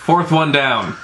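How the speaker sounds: ordinary talk, nothing marked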